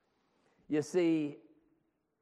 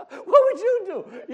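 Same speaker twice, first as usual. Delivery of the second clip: "What would you do?" slow, much higher and louder, through laughter; exaggerated laughing repeat; 17 dB louder, slower than usual. Shown as a demonstration. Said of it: "You're hearing the same words, right?".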